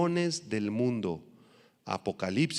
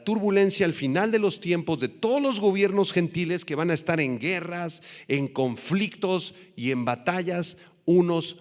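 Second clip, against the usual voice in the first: higher, louder preaching push; fervent preaching; 7 semitones higher, 4 dB louder than usual. Acted. Preacher warning the congregation about end-time wars, fervent. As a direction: drive the warning home to the church.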